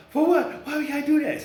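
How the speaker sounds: high, loud indignant squawk; mock panicked outburst. Woman panicking at the DNA test request, high and loud. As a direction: imitate her freaking out. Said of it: "Start breaking like that".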